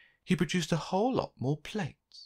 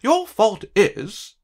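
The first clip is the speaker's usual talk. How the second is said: very slow, higher, louder climax; dramatic accusation; 10 semitones higher, 10 dB louder, much slower than usual. Acted. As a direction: spell out the punchline with outrage.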